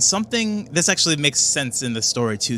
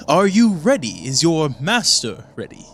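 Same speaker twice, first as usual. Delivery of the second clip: a hint of an impatient cultist checking in; one cultist asking whether the master is ready, mildly eager; eager henchman; 4 semitones higher than usual. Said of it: "And one of them goes".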